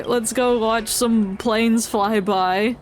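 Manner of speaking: ordinary talk, nothing marked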